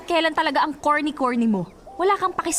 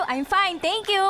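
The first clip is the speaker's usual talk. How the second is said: usual speaking voice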